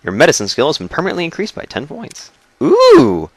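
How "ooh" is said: high delighted ooh, louder; excited ooh. Real, not acted. Player thrilled by his bobblehead bonus, high ooh. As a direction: squeal with delight at the reward.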